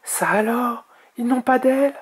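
put-on high, astonished young dragon. A narrator's reading. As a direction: question it in high-pitched amazement. Young dragon amazed by newcomers.

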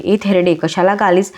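ordinary delivery, nothing marked

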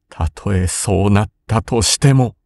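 usual speaking voice

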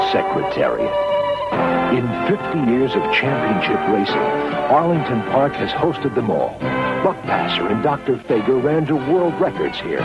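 usual speaking voice

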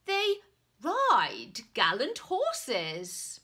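loud, slow pompous insistence; indignant knight. Acted. Knight correcting the shopkeeper, loud and pompous. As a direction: lecture him on knightly ways.

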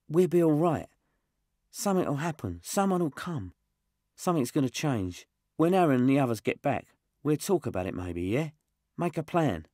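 lightly voiced kid Josh. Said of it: "he said quietly".

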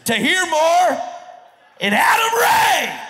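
high booming guest introduction; announcer shout. Acted. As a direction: stretch it out like a ring announcer. Bringing out the guests.

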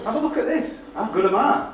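very high, loud playful bragging; proud showing off. Retold or imitated. Young designer carrying the book round, showing off proudly. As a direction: brag in a hammed-up squeal.

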